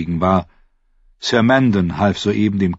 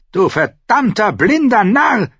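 high, raised, enraged outburst; furious cursing; 14 semitones higher, 4 dB louder than usual. A narrator's reading. Uncle cursing the nephew he knocked down, furious. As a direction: lash out in fury. Dwarf strikes and curses nephew.

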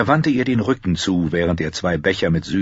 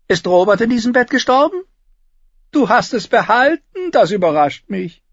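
high, loud character voice; raised, mock-curious probing; Tyrion, teasing; 5 dB louder than usual. Tyrion asking his sister about the royal bed, teasing.

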